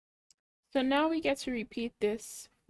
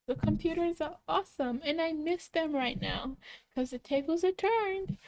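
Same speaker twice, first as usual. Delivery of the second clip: enthusiastic; 6 semitones higher than usual. Real, not acted. Praising computers with enthusiasm.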